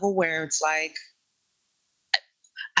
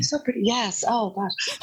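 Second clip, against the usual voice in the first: high mocking compliment; mocking quote; 4 semitones higher than usual. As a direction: gush it in a high voice. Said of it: "I've always been told".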